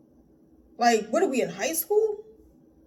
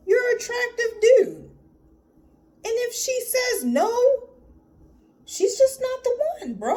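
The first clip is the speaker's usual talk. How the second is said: exasperated pep talk; 14 semitones higher than usual. Real, not acted. Pep talk to scared man.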